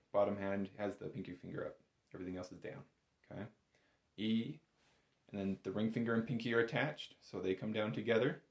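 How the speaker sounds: usual speaking voice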